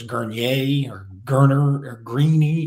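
Gurnier, Gerner, Greeny as three differing guesses at one name; guessed pronunciations. Retold or imitated. Broadcasters unsure of the surname, trying Gurnier, Gerner, Greeny. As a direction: slowly try out Gurnier, Gerner and Greeny in turn. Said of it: "they don't know if it's".

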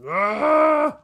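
loud, high drawn-out scream; sudden high shriek; startled scream. Real, not acted. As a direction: let out a high yell of alarm. Startled in game.

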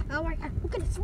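usual speaking voice

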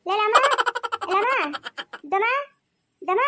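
high, short repeated calls; acted hollering. Calling a woman of the house over, hollering. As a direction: call out urgently in bursts. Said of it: "ಯಾಕೆ ಹಂಗ್ ಬಡ್ಕೊಂತ ಇದ್ಯಾ?".

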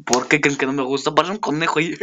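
ordinary talk

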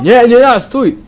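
high, loud cry to halt; panicked shout. Real, not acted. Player calling after a fleeing game character, high panicked shout. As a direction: yell after someone getting away. Woman runs away in game.

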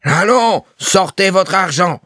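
very high, angry yelled order; clamouring shout. A narrator's reading. Landlord ordering the seamstress to pay, shouting angrily. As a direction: bellow the demand at full pitch. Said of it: "clamait Gorgerin".

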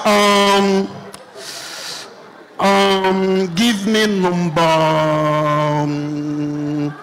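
low, drawn-out stalling over the order; hesitant ordering. Acted. Girl reading the menu to order, slow low dithering. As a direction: drag each word and hum, very low.

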